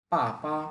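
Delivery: first পা short and falling, second পা light; পাপা with a falling first syllable; Mandarin tones. Shown as a demonstration. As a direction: snap the first পা down, the second পা neutral. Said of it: "প্রথমটা four tone, short করে বলতে হবে".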